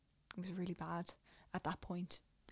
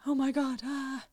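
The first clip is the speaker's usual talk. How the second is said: mild panic; 7 semitones higher, 7 dB louder than usual. Retold or imitated. Recalling realizing she's sick.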